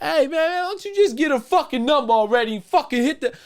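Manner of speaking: high mock yell of an annoyed customer; impatient man in line. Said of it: "these people".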